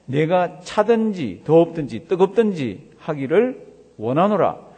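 loud, slow, measured Bible reading; emphatic scripture reading. Acted. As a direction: read the verse out forcefully, stopping to quiz the congregation. Reads scripture aloud to congregation.

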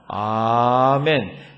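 long, loud, stretched amen; drawn-out amen. Real, not acted. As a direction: hold the amen out. Closing the group reading.

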